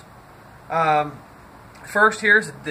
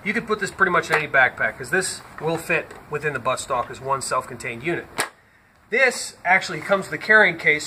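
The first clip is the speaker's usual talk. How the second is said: usual speaking voice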